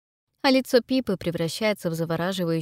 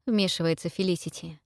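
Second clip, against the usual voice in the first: usual speaking voice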